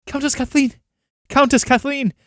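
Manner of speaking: calling out her name high, from afar; shouting a name. Acted. Peasants shout for the arriving countess, calling out high. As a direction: hail her across the crowd. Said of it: "stopped by shouts of".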